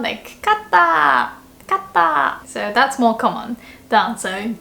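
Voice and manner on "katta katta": high bright cheer; gloating. Shown as a demonstration. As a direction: sing out the win. Gloating example.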